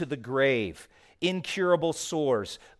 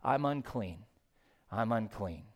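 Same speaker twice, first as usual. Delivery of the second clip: quiet lament; 5 semitones lower, 8 dB quieter than usual. Acted. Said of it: "take up the cry of the common leopard".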